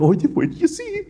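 very high, giddy voice, lightly held back. Acted. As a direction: tease a surprise in an excited high pitch. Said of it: "he gets giddy".

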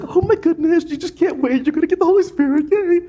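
high-pitched, giddy impression of Jesus. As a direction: squeal it like you can hardly wait. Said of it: "he gets giddy".

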